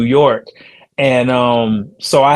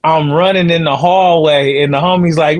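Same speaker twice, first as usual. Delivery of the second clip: ordinary talk, nothing marked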